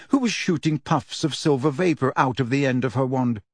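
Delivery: usual speaking voice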